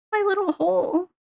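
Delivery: lightly voiced creature gloating over its hole; creepy little-creature voice. Streamer playing a creature guarding its cheese, lightly voiced. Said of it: "I shouldn't say that weirdly".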